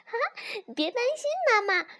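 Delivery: very high cub voice; little fox. A narrator's reading. Little fox reassures startled mother, high fox voice. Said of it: "小狐狸".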